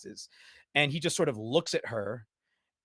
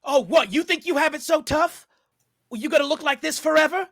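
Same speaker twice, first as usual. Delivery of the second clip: loud, high, combative retort; scornful shouting in a quarrel; angry taunt; 13 semitones higher, 8 dB louder, faster than usual. Acted. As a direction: throw it back in their face. Angry girl taunts in acted quarrel.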